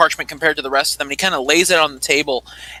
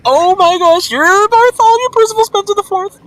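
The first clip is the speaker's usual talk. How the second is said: much higher and louder, starstruck squeal; fangirling; 6 dB louder than usual. Acted. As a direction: squeal at meeting your idol. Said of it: "just fangirling out a little bit".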